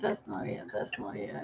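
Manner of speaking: under-breath mutter while searching; trailing off into a near-silent mutter; muttering to herself. Real, not acted. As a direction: mumble it to yourself, half-voiced.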